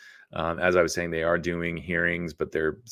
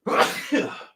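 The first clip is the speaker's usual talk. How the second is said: one sharp real sneeze; sneezing. Real, not acted. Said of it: "sorry, I'm gonna sneeze".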